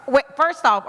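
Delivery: ordinary talk, nothing marked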